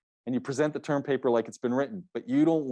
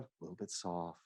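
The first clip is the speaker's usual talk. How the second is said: soft; 9 semitones lower, 16 dB quieter than usual. Shown as a demonstration. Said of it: "that feel is the vocal variety".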